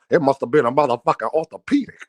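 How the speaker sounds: low, fast mock Senior quote; imitating Senior. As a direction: rattle it off low and quick like Senior. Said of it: "he said".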